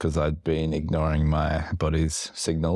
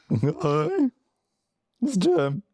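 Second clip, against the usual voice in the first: put-on drunk forties guy; 13 semitones higher, much slower than usual. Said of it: "You just sound like a drunk guy from the forties".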